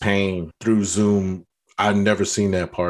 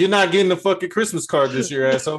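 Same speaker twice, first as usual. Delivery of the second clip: mock angry rant; 8 semitones higher than usual. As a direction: fake furious at a friend. Mock rant over fur babies.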